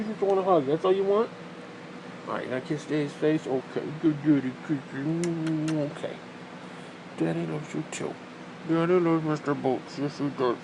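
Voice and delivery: soft, cooing pet voice. Real, not acted. Man cooing lovingly to his dog Mister Boots, soft. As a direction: coo at the dog softly.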